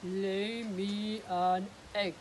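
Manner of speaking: very slow, loud giant order. Acted. Giant ordering the hen to lay, booming. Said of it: "he said to the hen".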